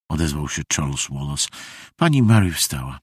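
plain reading voice

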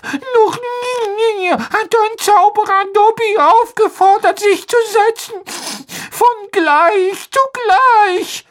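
slow, very high crying elf voice; sobbing. A narrator's reading. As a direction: weep over being treated as an equal. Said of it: "schluchzte der Elf".

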